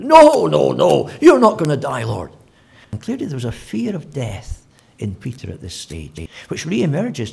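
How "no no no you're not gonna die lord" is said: loud, high, desperate no no no; Peter's loud protest. Acted. Peter shouting at Jesus to refuse his death, loud and high. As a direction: shout him down like a frightened friend.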